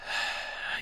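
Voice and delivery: soft, breathed-out sigh. Acted. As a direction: let out a quiet sigh before answering. Clown sighs before explaining.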